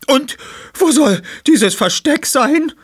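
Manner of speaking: fordernde Frau. Acted. Dicke Frau drängt sich vor.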